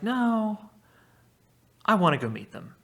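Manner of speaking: a high "No", then a firm insistence; childish insistence from the hero; stubborn Odysseus. Retold or imitated. Odysseus refusing the crew, high and stubborn. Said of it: "he's like".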